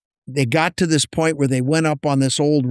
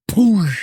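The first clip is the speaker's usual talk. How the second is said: imitated blast; 6 semitones higher, 4 dB louder than usual.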